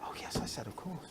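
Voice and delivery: very soft, near-whispered retold answer; hushed reply. Crafter retells answering his friend's correction, hushed. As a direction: drop right down to a small, hushed voice.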